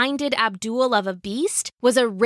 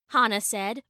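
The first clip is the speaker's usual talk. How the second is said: plain reading voice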